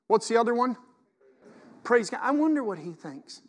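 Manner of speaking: ordinary delivery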